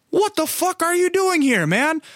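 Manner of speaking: very high, delighted-surprise greeting, acted out; high-pitched mock-amazed hello; excited greeting. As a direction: replay bumping into an old pal. Retold greeting of unexpected acquaintance.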